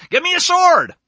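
loud, high-pitched royal order; king's command. Acted. King ordering his men to bring a sword, loud command. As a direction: call out a sudden sharp demand.

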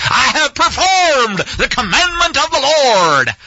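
slow, grandiose TV preacher, with a laugh; smug televangelist proclaiming his obedience. Retold or imitated. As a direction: ham up a pompous televangelist. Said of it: "He sounds like a T V preacher".

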